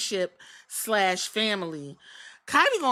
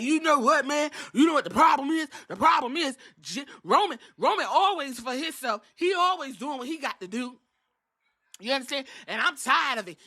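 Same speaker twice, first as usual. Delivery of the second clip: a touch of whiny Jey; 5 semitones higher than usual. Retold or imitated. Jey griping to Jimmy about Roman, lightly higher whine. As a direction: complain like a fed-up brother.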